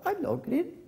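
very high little protest; indignant girl. Acted. Girl protests the echo's words.

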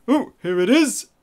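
normal talking voice

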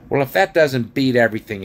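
exasperated dwarf outburst; exclaiming dwarf. A narrator's reading. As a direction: burst out in disbelief. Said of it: "exclaimed Diggle".

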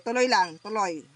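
ordinary delivery

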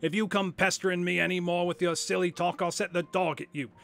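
loud, high-pitched tirade; angry shout. Acted. Salesman warning off the little man, angry shouting. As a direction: lay into the pest at full voice. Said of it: "he shouted".

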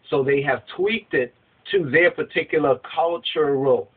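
ordinary delivery

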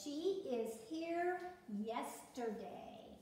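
reads the sentence out slowly, word by word; slow, careful classroom reading, leaning on here; slow read-aloud. Shown as a demonstration. Teacher reading the wrong flip chart sentence to the class, slowly. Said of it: "And it says".

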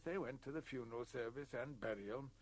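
usual speaking voice

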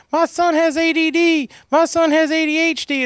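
high whiny mocking parent voice. Mocking parents who blame their son's ADD, high and whiny. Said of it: "you know, like".

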